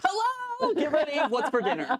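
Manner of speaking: playing Ian. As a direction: ham up a cheery arrival, laughing. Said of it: "It might be Ian coming to your house".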